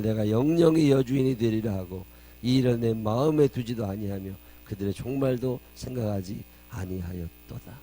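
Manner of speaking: very loud declamation, slowing into the close; forceful scripture proclamation. A narrator's reading. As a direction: thunder out the verse, then draw out its ending. (우렁차게 선포하다 끝을 늦추는 성경 봉독)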